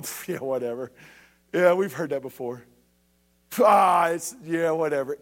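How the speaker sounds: low, lazy brush-off; mock dismissive scoff. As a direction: scoff like a bored crowd ignoring a prophet. People dismissing the prophet voiced.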